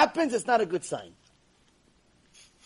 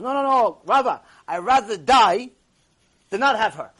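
raised-voice, dramatic lovesick protest; emphatic protest; 2 semitones higher, 7 dB louder than usual. Retold or imitated. Lovesick man refuses the rabbi's advice, mock-desperate insistence.

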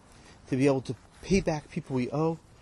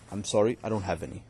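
lower, flat refusal from the boss; stingy employer; 6 semitones lower than usual. Acted. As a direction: brush him off from a low register.

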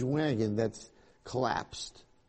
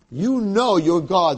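indignant outburst; 5 semitones higher, 8 dB louder, slower than usual. Acted. Yaakov protesting to Hashem.

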